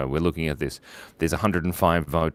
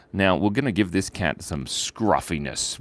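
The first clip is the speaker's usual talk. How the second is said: usual speaking voice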